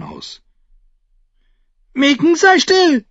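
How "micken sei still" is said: put-on very high, loud boyish squeak; a boy's order coming out as a shrill squeal; shrill falsetto. A narrator's reading. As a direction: try to command, but crack into falsetto. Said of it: "in einem schrillen Fistelton".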